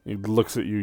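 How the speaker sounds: usual speaking voice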